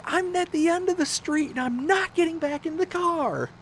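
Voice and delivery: imitating the frantic wife's plea in falsetto; pleading wife. Wife refusing, retold.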